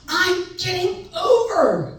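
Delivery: slow, high and loud, each word spelled out; drawn-out irritated reply; annoyed retort. Retold or imitated. Son answering his backseat-driving mother, irritated and slow. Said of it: "I was so mad at her".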